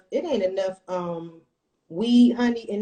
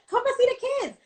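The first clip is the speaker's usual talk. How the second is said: much higher, gushing with joy; excited reunion. Retold or imitated. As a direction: greet an old friend with delight, pitched way up. Retold joyful reunion with sister.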